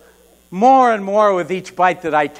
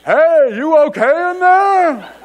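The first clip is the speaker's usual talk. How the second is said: high, drawn-out loud call; slow, raised holler to someone shut away; calling through a door; 9 semitones higher, 5 dB louder, slower than usual. Acted. Friend checking through the bathroom door, calling out. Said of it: "John's voice through the bathroom door".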